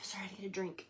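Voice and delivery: whispered apology; hushed sorry after a sip; whispered aside. Real, not acted. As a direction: whisper it quickly off-mic.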